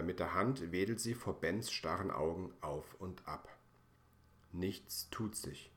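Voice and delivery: usual speaking voice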